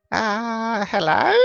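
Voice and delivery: high curious squirrel; a tiny high hello. Acted. The squirrel greets the party, high and curious. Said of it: "and goes".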